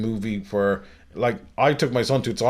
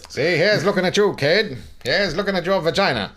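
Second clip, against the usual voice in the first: high-pitched old-movie star impression; James Cagney; 6 semitones higher than usual. Co-host playing along with the joke, as a high hammed-up Cagney. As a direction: ham it up like a classic gangster actor. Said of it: "So James Cagney came back from the grave".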